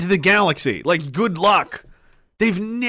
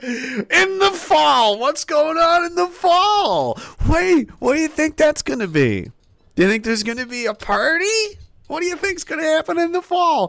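mocking; 9 semitones higher than usual. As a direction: play dumb to mock him. Mocking the PM's fall warning.

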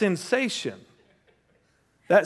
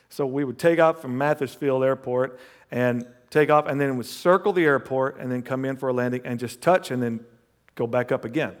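normal talking voice

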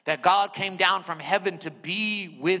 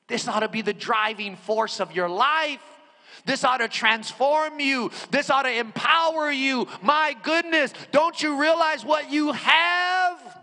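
full-force preaching; 6 semitones higher than usual. Acted. Preacher rousing the congregation about approaching God, full-force preaching. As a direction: preach it to the room.

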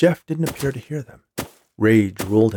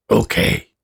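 raspy gravelly agreement; a breathy low rasp; gravelly snowman okay; 4 dB louder than usual. Acted. Snowman promises the boy, raspy gravelly okay.

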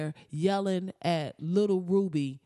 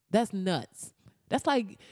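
usual speaking voice

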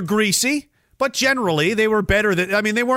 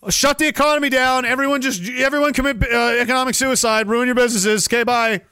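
high, chirpy mock decree; mock breezy official; 6 semitones higher than usual. Mocking officials ordering the shutdown, chirpy high decree. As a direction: toss off the order casually.

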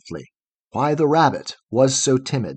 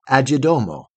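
plain reading voice

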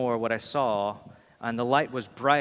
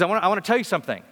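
rushed, breathless eager burst, louder and higher; excited; 5 semitones higher, 5 dB louder, much faster than usual. Retold or imitated. Excited churchgoer grabbing the pastor after service, rushing her words. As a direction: blurt it out fast like you can't hold it in. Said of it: "really, really excited".

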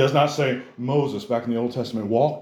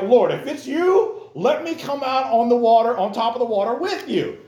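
eager Peter; 10 semitones higher, 3 dB louder than usual. Retold or imitated. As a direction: beg like an overeager disciple. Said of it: "Peter's like".

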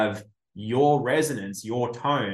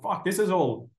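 a shocked, higher exclamation; stunned outburst; 8 semitones higher than usual. Retold or imitated. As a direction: pitch leaps up on "Fuck, this is all". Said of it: "And I'm like".

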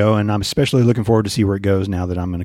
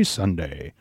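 low, soft falling Sunday; deep low voice; 7 semitones lower, 8 dB quieter than usual. Acted. Repeating Sunday with varied tunes.

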